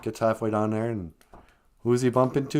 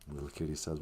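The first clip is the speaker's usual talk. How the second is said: ordinary delivery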